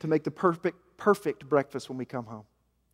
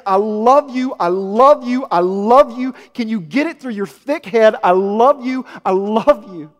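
loud, higher preaching build; impassioned preaching; 7 semitones higher, 12 dB louder than usual. Acted. Pastor to congregation, preaching loudly about paradise. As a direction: preach it out to the whole room.

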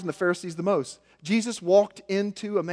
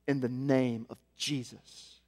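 hushed awe; 8 semitones lower, 11 dB quieter, slower than usual. Retold or imitated. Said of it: "he says".

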